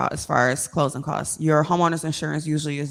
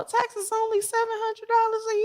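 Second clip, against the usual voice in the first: high, surprised naive buyer; 4 dB quieter than usual. Retold or imitated. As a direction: act out a delighted, naive buyer. Naive home buyer reading listing.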